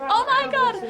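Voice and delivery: high excited "Oh my God!"; mock shocked cry. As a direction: squeal it out, high and loud. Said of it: "I was like".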